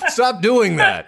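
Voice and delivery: high, laughing plea to stop; laughing mock plea. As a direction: beg them to stop through laughter. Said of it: "Irish person in a life-threatening situation".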